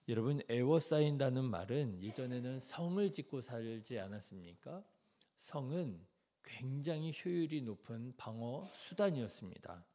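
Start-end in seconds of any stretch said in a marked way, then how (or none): none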